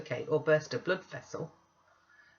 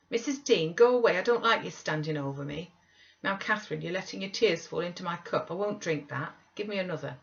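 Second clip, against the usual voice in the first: whiny Linton; 3 semitones higher than usual. Acted. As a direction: demand tea like a spoiled invalid. Linton petulantly orders them about.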